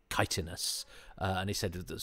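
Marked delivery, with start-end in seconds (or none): 0.1-0.8 chitinous spoken as a quiet correction; correct pronunciation. Shown as a demonstration. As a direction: carefully say chitinous the way it should sound. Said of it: "I think it should be pronounced".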